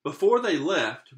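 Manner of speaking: usual speaking voice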